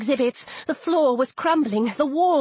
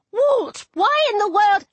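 high-pitched exasperated call; exasperated cry; 7 semitones higher than usual. A narrator's reading. Heroine scolding Walt over the camel, high exasperated cry. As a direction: call out in disbelief, pitched well up.

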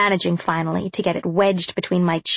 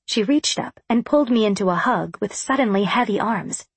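usual speaking voice

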